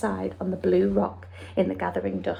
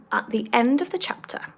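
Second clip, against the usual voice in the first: usual speaking voice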